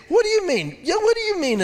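very high, nasal-free squeaky mock whine; high whiny parody of the complaint. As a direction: parody their indignant protest. Mocking the people's complaint.

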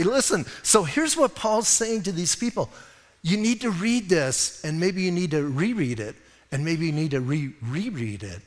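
usual speaking voice

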